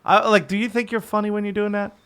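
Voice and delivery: ordinary talk, nothing marked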